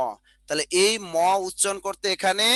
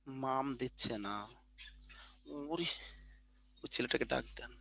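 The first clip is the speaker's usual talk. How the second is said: far-off aside; 6 semitones lower, 17 dB quieter than usual. Real, not acted. Off-mic aside to someone else.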